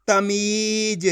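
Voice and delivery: तमीज with a long-held मी; teacher blending तमीज with its middle vowel stretched. Shown as a demonstration. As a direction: draw out the ee in तमीज. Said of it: "खींच के पढ़ा जाता है".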